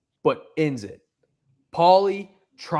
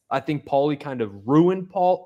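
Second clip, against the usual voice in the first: normal talking voice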